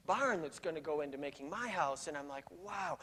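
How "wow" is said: awed wow. Retold or imitated. Awed by the old man's story.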